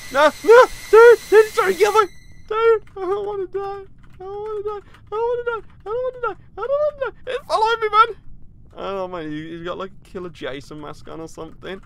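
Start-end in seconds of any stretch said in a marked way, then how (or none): 0.1-8.1 much higher, louder squealing plea; hammed-up terror, begging not to die; panicked pleading. Fleeing the killer, squealing at the player chasing him in panic.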